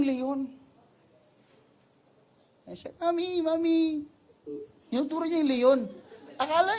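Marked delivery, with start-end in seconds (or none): 3.0-3.8 put-on high small child's voice calling mami; a little child tugging at mommy, unaware. Child at the lion cage calling to mother, high childlike voice.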